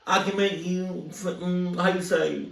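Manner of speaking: lightly put-on French accent, sleazy charm; a hint of French creep, playful; fake French accent. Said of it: "I was trying to go for, for French".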